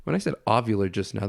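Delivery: normal talking voice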